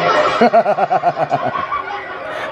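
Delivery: laughing at his own line. Real, not acted. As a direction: chuckle it out.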